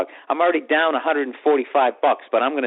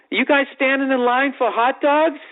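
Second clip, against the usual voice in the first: high, slow, exaggerated sarcastic question; dripping with sarcasm; 10 semitones higher than usual. Lady at car window to people in line, dripping sarcasm. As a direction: drawl it high with mock interest. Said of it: "starts dripping with sarcasm".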